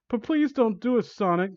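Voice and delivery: high, pleading squeak. Acted. Amy begs Sonic not to, in a high squeak. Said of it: "Amy squeaked".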